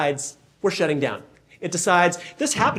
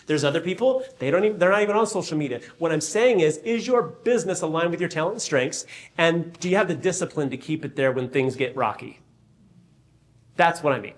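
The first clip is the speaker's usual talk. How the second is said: ordinary talk, nothing marked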